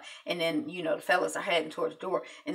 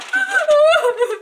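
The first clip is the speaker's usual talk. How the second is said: laugh-cry; 12 dB louder than usual. Real, not acted. Laugh-cry while recapping.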